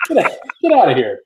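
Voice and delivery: high, loud mock dismissal; retold pissed-off. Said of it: "somebody goes over and gets pissed".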